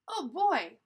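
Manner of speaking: put-on loud, lifted, eager oh boy; excited. Shown as a demonstration. Reader shows how Annie really says it, excited. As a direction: say it like she's excited. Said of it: "like she's excited".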